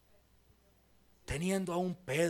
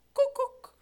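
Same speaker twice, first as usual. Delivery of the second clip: high, loud chirping beep; car-alarm beep imitation; 8 dB louder than usual.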